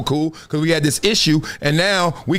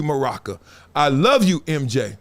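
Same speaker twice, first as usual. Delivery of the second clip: ordinary talk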